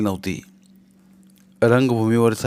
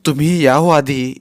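scared; 4 semitones higher, 6 dB louder than usual. Acted. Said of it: "ती घाबरून म्हणाली".

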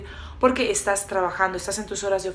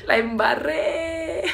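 high, very slow mock lament; comic wail; 6 semitones higher, much slower than usual. Entrepreneur hitting bottom after failing, comically wailing. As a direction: drag it out as a hammed-up whine. Acting out entrepreneur's low moment.

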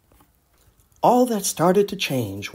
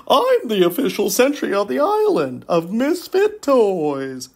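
toy sentry; 6 semitones higher than usual. Acted. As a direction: announce yourself like a toy guard. Jack-in-the-box sentry speaks.

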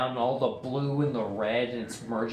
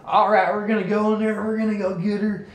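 put-on southern accent; 7 semitones higher, 5 dB louder than usual. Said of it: "he has this heavy-ass southern accent".

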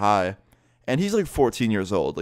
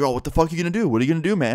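higher-pitched imitation of a guy squaring up; retold challenge, pitched up; mock confrontation; 8 semitones higher, much faster than usual. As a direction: mimic someone goading for a fight. Imitated dude squaring up to him.

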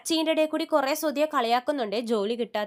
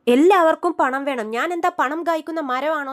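angry retort; 4 semitones higher, 9 dB louder than usual. Retold or imitated. Sister fed up with money demands, loud angry retort. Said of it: "ദേഷ്യത്തിൽ ചോദിക്കുവാണ്".

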